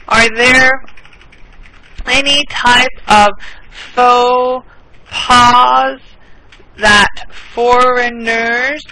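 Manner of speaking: each word spaced out, very slow; slow it right down for learners; teacher's extra slow repeat; slow and clear. Shown as a demonstration. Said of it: "(repeats the question slowly)".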